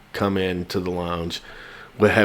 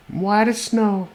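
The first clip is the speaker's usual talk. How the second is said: high sing-song; 13 semitones higher, slower than usual. Acted. Joke line, high slow.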